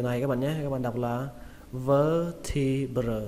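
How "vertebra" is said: "vertebra" drawn out slowly, ending in "brơ"; vertebra syllable by syllable. Shown as a demonstration. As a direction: stretch "vertebra" into slow syllables. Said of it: "âm vơ". (đọc "vertebra" chậm từng âm vơ-thi-brơ)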